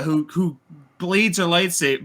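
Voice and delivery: ordinary delivery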